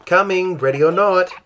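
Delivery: put-on child calling out in a game; playful call. Children racing each other calling out in play. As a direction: sing it out high and loud, a hide-and-seek call. Children playing hide and seek.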